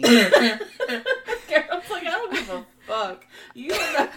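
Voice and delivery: loud, cracking up mid-quote; real helpless laughter through the words; laughing while talking. Real, not acted. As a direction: barely get the words out for laughing. Cracks up while quoting Geralt.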